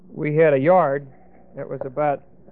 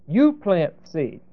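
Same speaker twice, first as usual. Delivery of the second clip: normal talking voice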